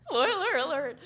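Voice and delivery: voice cracking. Real, not acted. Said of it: "My voice just cracked".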